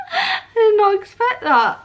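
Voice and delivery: crying while laughing at herself; real tears, surprised by them. Real, not acted. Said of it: "why am I getting emotional".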